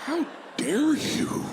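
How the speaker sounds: high, slow mock indignation; mock outrage. A customer, scandalized by the salesman, in a high, slow mock outrage. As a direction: gasp it out like a scandalized lady.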